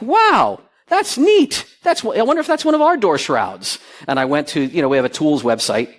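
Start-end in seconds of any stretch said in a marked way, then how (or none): 0.0-3.8 much higher, delighted surprise; excited wonder. Retold or imitated. At the hotel, spotting his own product, delighted and high. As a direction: marvel at spotting the shrouds. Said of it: "and I was like".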